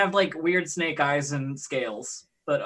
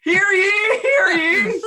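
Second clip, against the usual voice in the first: very high, loud mock crier; herald's proclamation; 10 dB louder, slower than usual. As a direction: cry it out like a trumpeting herald. Plays the trumpeting angel herald.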